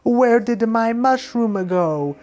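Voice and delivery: high, slow, worried cartoon plumber; Mario voice. Mario finds his pouch empty, high slow hammed-up dismay. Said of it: "he'd be like".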